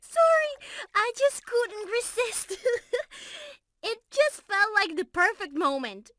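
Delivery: can't stop laughing at the prank; laughing apology. Real, not acted. Pushed him into water, giggling.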